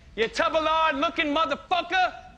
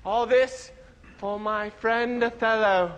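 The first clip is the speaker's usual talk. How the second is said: low, slow dying actor; lightly hammed death line; dying stage hero; 8 semitones lower, slower than usual. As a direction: sink into a last breath.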